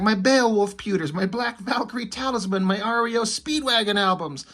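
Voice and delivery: high-pitched mock nerd lament; total nerd. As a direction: play a distraught nerd over his wrecked collection. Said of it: "like, a total nerd".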